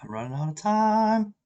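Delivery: pressed for time. Real, not acted. Player running out of time on the clock, alarmed wail.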